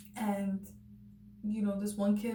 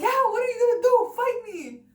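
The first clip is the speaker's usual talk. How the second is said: high, loud, defiant mock yell; yelling back; 14 semitones higher, 12 dB louder than usual. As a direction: yell it like you're daring him to swing. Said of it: "And so I started yelling back".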